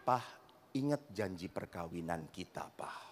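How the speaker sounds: soft, low, unbothered reply; calm him down, totally cool; calm and quiet. Acted. Wife calmly answering her angry husband, soft and low. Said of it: "istrinya dengan tenang ngomong".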